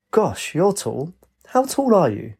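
put-on high, wondering old gent; amazed. A narrator's reading. Old golfer looking up at tall Lynx, amazed. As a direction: marvel at someone's height.

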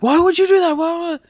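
high, loud mock outrage at a coworker; imitating angry coworker. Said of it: "And he was so mad".

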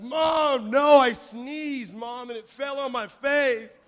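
high, loud mock tantrum; indignant boyish yell. Boy caught crying yells at his mom, mock indignant shout. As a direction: yell it drawn out, like an embarrassed kid.

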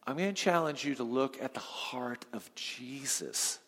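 whispered, soft and low; a confiding whisper to the congregation; hushed challenge. Real, not acted. Preacher drops to intimate challenge.

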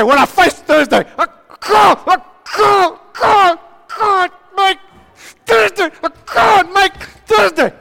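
high, loud, cracking cry that you can't go on; worn-out man shouting he can't make Thursday; desperate shouting. Acted. Acted collapse under weekly stress.

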